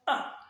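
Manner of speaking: "A" cut very short; brief, abrupt "A"; fourth tone. Shown as a demonstration. Teacher models the brief fourth tone, "A" cut short. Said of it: "comme ça".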